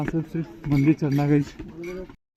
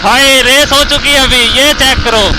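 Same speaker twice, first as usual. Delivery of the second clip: loud excited yelling; 7 semitones higher, 9 dB louder, slower than usual. Real, not acted. Yelling over wind during race.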